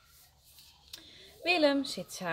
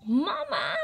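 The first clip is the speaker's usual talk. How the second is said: high, loud cry for mother; yelling; 5 semitones higher, 5 dB louder than usual. A narrator's reading. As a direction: yell for help like a small child. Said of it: "gilt Willem".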